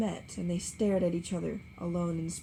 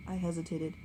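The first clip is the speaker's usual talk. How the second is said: usual speaking voice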